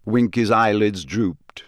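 plain reading voice

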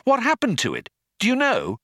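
high-pitched, urgent questioning; sudden excitement. A narrator's reading. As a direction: press eagerly for the answer. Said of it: "said Ford in sudden excitement".

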